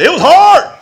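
sudden high, loud cry of strain; exasperated outburst. Retold or imitated. Preacher admitting how hard holding back was, exasperated shout. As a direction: burst out with it at full voice. Bursts out recalling his struggle.

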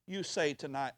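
ordinary talk, nothing marked

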